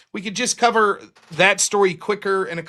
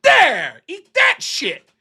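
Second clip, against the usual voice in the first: higher, mock triumphant taunt; gloating taunt; 6 semitones higher, 4 dB louder, slower than usual. Speaker taunting the rude boss, higher mock gloating. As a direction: rub it in at the lady. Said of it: "I was like".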